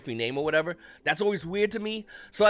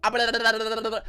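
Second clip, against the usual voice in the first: mock babbling of a churchgoer in higher pitch; imitating tongues; 6 semitones higher than usual. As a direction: lightly mimic a man speaking in tongues. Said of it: "go like this".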